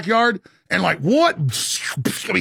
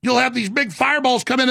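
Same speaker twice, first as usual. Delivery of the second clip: ordinary delivery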